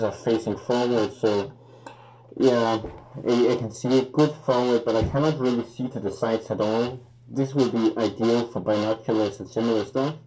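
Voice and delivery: muffled behind rubber mask; speaking through respirator filter; through a gas mask. Real, not acted. Seen 3: Full-head gas mask worn throughout, mouth covered; refs show bare face.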